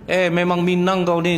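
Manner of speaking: high, jeering jab; mock insulting husband. Husband mocking his wife's Minang roots, mock jeering. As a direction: sneer at her origins. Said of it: "kata suaminya".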